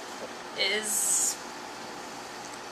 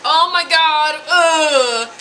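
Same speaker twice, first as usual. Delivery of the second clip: loud, higher mock groan; exasperated dad; 5 semitones higher, 7 dB louder, much slower than usual. Fish-girl's dad reacting to her, mock exasperation. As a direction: imitate a father utterly fed up. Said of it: "Ponyo's dad is like".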